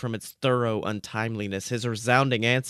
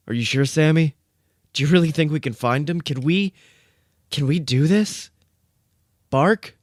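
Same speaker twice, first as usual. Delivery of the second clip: nervous; 5 semitones higher, 5 dB louder than usual. A narrator's reading. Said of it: "Dean tentatively asked, ... horribly nervous".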